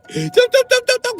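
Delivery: very high, loud engine noise; high revving tum tum; imitated engine. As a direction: make the car revving sound. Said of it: "Her shit was like".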